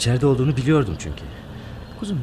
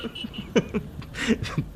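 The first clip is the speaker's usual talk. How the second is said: soft, voiceless laughter; laughing at himself; 11 semitones higher, 6 dB quieter than usual. Acted. Fiancé answers her question by laughing at himself, laughing. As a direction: let a quiet, rueful laugh run on. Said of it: "Kendi durumuma gülüyorum".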